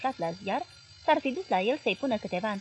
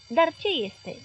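higher, mildly worried question; uneasy; 7 semitones higher, 3 dB louder, slower than usual. A narrator's reading. Boss questioning his hesitant journalist by phone, uneasy. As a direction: ask with a touch of unease. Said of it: "întrebarea acum neliniștită a marelui ziarist".

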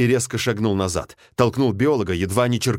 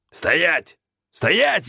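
shouted order; 8 semitones higher than usual. Acted. Pilot orders them at gunpoint.

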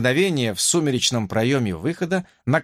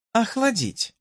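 commanding; 10 semitones higher, slower than usual. A narrator's reading. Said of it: "распорядилась принцесса".

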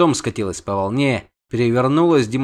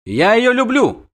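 high, louder firm avowal; firm declaration; 11 semitones higher, 4 dB louder than usual. A narrator's reading. Prince avows his love for a siren, firm. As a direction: declare it with conviction. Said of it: "твёрдо сказала главаль".

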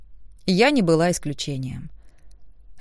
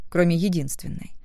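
plain reading voice

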